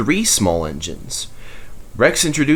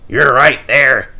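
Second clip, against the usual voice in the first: hearty agreement; 5 semitones higher, 6 dB louder, slower than usual. A narrator's reading.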